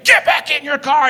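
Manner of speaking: high, loud shouted order; furious acted shout, pitch way up; retold angry scream. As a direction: scream at the other driver. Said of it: "I'm screaming at him".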